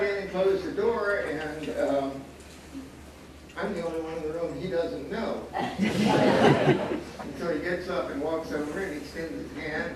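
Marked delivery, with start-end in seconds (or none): 5.5-7.2 breathy laugh. Real, not acted. Amused at his own story.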